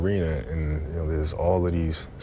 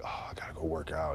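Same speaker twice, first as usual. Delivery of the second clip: reluctant chore; 10 dB quieter than usual. Acted. Player mimicking someone who sees training as work, mock reluctance. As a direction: groan it softly like a burden. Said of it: "It's not, it's not like".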